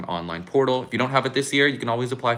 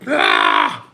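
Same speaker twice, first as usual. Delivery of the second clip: short loud yell of frustration; exasperated shout; frustrated scream; 10 semitones lower, 12 dB louder than usual. Real, not acted. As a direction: let out a sharp yelp at the broken stream. Frustrated by broken stream signal.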